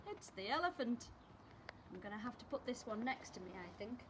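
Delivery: ordinary delivery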